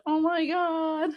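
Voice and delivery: slow, high, touched gasp of delight; delighted oh my God. Retold or imitated. Mother melting when her son kisses her, slow high delight. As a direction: melt at a rare kiss.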